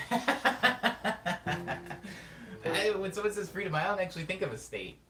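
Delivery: high, loud start out of a laugh; amused, laughing. Real, not acted. Streamer cracking up at the freedom question, amused. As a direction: answer while recovering from a big laugh. Laughing at own joke answer.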